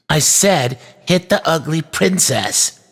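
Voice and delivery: put-on high, demanding child's voice; insistent boy. A narrator's reading. Boy repeats his order to the toy knight, insistent. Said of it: "insisted the boy".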